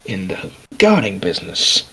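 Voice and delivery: low, soft, halting character voice; reserved job seeker. Acted. Adventurer asking the merchant for work, quiet and reserved. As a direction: ask for work quietly, searching for words.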